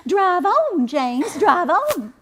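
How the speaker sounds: high, haughty command to the driver; haughty lady. Retold or imitated. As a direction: look down your nose and order him on. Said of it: "in her most haughty voice".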